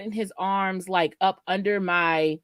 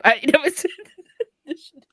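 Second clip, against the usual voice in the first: high, words broken by real laughter; laughing while talking; 7 semitones higher, faster than usual. Real, not acted. Guest admitting she never told this part, laughing. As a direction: confess it through leftover giggles. Still laughing at her confession.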